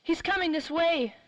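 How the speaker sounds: alarmed, high urgent warning; sudden frightened alert. Acted. Police car approaching hiding group.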